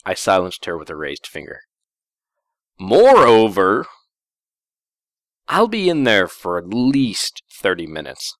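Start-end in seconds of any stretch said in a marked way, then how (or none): 2.8-8.4 pointed slow hint. Acted. Heavy-handed hint continues.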